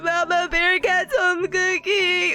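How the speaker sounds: slow, dreamy moan of craving for home food; drawn-out yearning ooh; longing swoon. Retold or imitated. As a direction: swoon over it like you can taste it. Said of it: "So I'm like".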